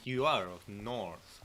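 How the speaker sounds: ordinary talk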